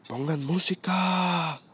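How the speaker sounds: put-on slow, high, slurred drunken demand; mock drunk slur, stretched and high; drunk friend's voice. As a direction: imitate the drunk buddy calling out, dragging it. Drunk friend demanding music at party.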